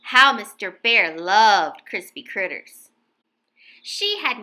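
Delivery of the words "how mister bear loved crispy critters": ordinary delivery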